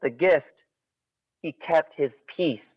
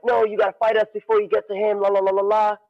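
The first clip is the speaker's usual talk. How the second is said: eager students; 7 semitones higher, faster than usual. Retold or imitated. Students blocking the challenger.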